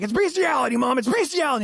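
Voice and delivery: nearly shouting the word to a confused mother; exasperated shout. Retold or imitated. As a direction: raise your voice so mom finally gets it. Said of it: "I'm like".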